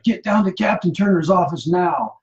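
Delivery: worked-up military order, retold; fired-up order. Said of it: "he got all fired up".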